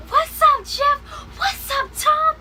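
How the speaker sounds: high, loud squealing hello; excited fan greeting. Retold or imitated. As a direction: squeal the greeting at your idols. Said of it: "Allie was like".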